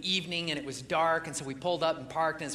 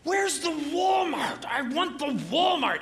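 high, slow, demanding shout; imitating a grumpy man's outburst; angry shout; 8 semitones higher, 3 dB louder, slower than usual. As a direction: yell it at the bell ringer like a fed-up customer. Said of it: "And he goes".

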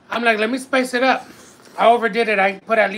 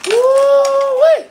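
high, loud whoop of heat; spicy food whoop; 7 dB louder than usual. Real, not acted. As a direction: yelp from the burn.

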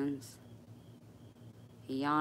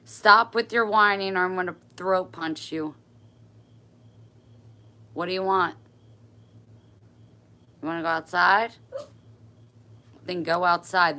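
loud, sharp scolding, higher; scolding the dog; 5 semitones higher, 12 dB louder than usual. Real, not acted. Owner scolding her whining dog Max, loud and fed up. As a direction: tell off a whining dog at full volume.

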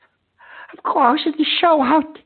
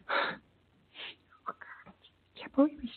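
hushed, unvoiced disbelief; shocked whisper; 7 semitones lower, 6 dB quieter than usual. Real, not acted. Host [name] whispering in shock after a death on his show. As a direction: whisper it to yourself, stunned.

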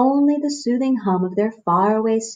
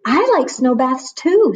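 little bear voice; 5 semitones higher, 6 dB louder than usual. A narrator's reading.

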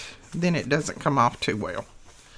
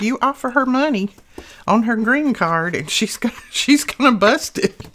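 higher, louder, laughing through the end; laughing quote; 6 semitones higher, 5 dB louder than usual. Retold or imitated. Retelling daughter's joke about granddaughter, laughing. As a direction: tell it while cracking up.

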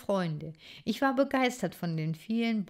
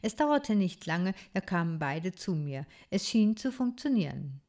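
usual speaking voice

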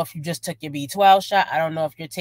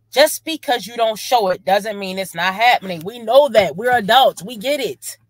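louder, higher, emphatic rebuke; exasperated scolding; 4 semitones higher, 6 dB louder than usual. Real, not acted. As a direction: spell it out loudly to someone not listening. Exasperated reaction to the vlogger.

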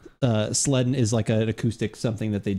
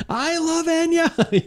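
high mock voice; much slower than usual. High slow mock aside.

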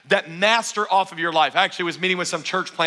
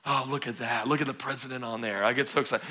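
mock doting on money; 6 semitones lower, 9 dB quieter than usual. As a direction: coo at a banknote like a lover. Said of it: "nobody actually looks at money and goes".

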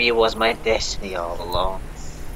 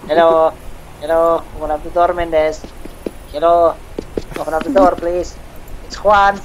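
loud, higher-pitched caller at a door; Juan calling out; 5 semitones higher, 4 dB louder than usual. Pretend. Juan at Mendez's door, calling out loudly. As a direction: call insistently for someone to open up.

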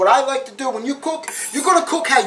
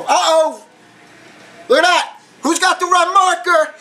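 surprised; 6 semitones higher than usual. Real, not acted. Onion juice stains red.

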